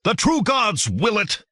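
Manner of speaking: high, ringing zealot's yell; hillman's frenzied war yell; fanatic yell. A narrator's reading. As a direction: scream it while charging. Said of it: "he yelled".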